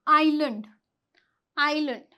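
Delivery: "आइलैंड" as eye-lund, no s; "आइलैंड" carefully, gliding straight from eye into l; silent S. Shown as a demonstration. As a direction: leave the s out of "आइलैंड". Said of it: "एस है हमारा वो silent हो गया".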